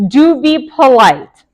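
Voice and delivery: normal talking voice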